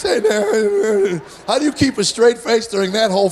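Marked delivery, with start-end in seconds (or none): 0.0-1.2 put-on a bit higher and louder, slow boyish "Hey, Dad"; teen son with unbroken voice greets dad. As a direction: imitate a big kid whose voice never dropped. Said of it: "voice never dropped".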